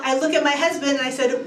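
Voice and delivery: ordinary talk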